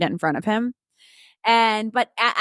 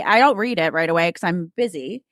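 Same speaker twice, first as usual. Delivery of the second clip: usual speaking voice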